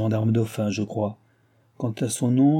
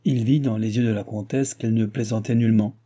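plain reading voice

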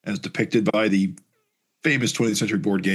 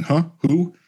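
startled; 8 semitones higher, 3 dB louder than usual. Acted. Pharaoh snapping awake.

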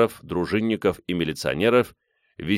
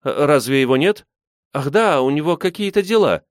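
feigned surprise; 7 semitones higher, 4 dB louder than usual. A narrator's reading. Nadya lying badly.

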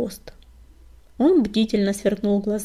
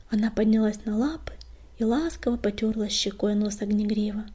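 ordinary delivery

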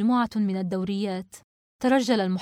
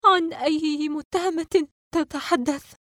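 high, slow, trembling question; 8 semitones higher, slower than usual. A narrator's reading. Shocked girl asks the investigator about the suspect, trembling. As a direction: stammer it out, shaking with shock. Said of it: "وقالت وهي ترتجف".

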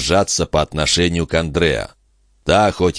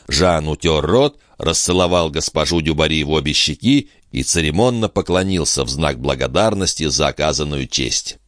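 plain reading voice

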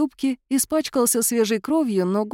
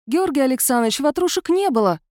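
calling out; 6 semitones higher than usual. Acted. Maid calls from the corridor.

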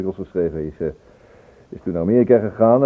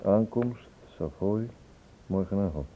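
softly reading the telegram aloud to himself, word by word; quiet, absorbed read-through; reading half aloud; 7 dB quieter, slower than usual. Real, not acted. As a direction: murmur each word as you read it.

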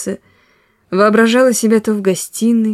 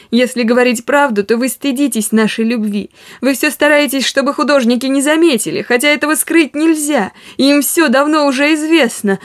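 higher, tearful accusation; through tears; 6 semitones higher than usual. A narrator's reading. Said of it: "сказала она и зарыдала". (сквозь слёзы, на высоких нотах)

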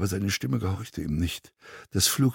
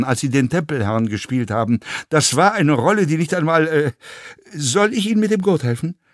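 lively, backhanded praise with stumbles; condescending fellow passenger; 8 semitones higher, 6 dB louder than usual. A narrator's reading. Passenger recalls actor's one good role, condescending. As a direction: hand out grudging praise that turns to insult.

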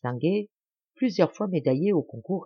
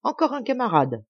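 exclaiming; 7 semitones higher than usual. A narrator's reading. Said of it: "exclama l'amiral".

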